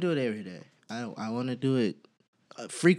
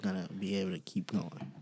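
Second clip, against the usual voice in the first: low, soft and sincere; gentle reassurance; 7 semitones lower, 6 dB quieter than usual. Real, not acted. Man telling the ladies he knows his limits, gently reassuring. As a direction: let them down gently, voice dropping low.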